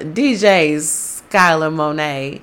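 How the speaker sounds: usual speaking voice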